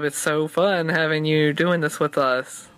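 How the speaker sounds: normal talking voice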